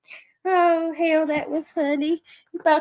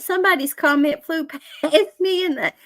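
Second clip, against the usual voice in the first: ordinary talk, nothing marked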